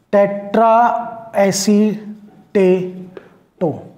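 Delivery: extremely slow, broken into syllables; slow dictation. Shown as a demonstration. Teacher finishing the dictated ligand name, slow dictation. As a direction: pause after each syllable.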